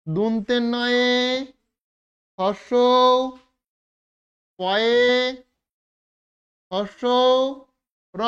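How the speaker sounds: দুন্তে নয়ে slowly, then Harsh, পয়ে, Harsh, র, each with a pause; teacher dictating each part slowly; broken into parts. Shown as a demonstration. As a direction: spell Nupur out part by part. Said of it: "নূপুরটাকে যদি আমরা ভাঙি".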